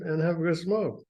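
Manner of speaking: plain reading voice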